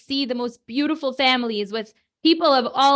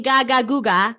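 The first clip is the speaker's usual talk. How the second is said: put-on baby babble; 4 dB louder than usual. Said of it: "they might just be saying".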